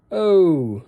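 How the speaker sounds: a sharp, loud, high yelp of surprise; sudden surprised oh. Real, not acted. Streamer reacting to the game with a startled loud oh. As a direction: blurt it out, caught off guard.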